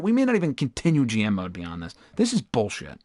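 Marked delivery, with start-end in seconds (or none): none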